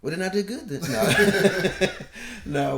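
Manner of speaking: high, laughing through the words. Real, not acted. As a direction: crack up while protesting. Laughs at son's remark.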